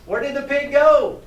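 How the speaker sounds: high, loud, bewildered call; alarmed cry. A narrator's reading. Animals find the pig gone, crying out. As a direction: cry out in storybook dismay. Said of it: "the animals cried".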